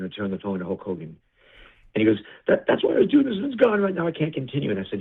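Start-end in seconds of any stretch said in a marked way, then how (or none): 2.5-4.7 imitating a distraught man, high voice sinking; mock tearful plea, pitched way up at first; retold crying. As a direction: start high, then let it drop in defeat. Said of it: "He called me crying".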